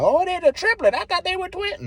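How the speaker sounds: very high squeaky mock voice; mock onlooker. Bystanders mistaking him for a third [name] and [name], squeaky mock voice.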